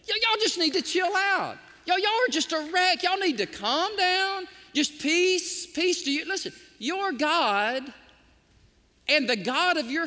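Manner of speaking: a steward telling the brothers to chill out; excited steward. Retold or imitated. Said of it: "give you my translation of that".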